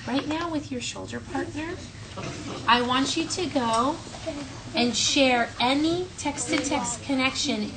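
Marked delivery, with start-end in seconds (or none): none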